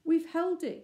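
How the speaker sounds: high, loud victorious cry; triumphant cry. A narrator's reading. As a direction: shout it out, the door has held. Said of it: "cried Robert".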